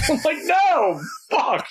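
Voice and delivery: high, cracking up through the words; laughing. Real, not acted. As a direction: refuse while breaking into laughter. Dad laughing at daughter's request.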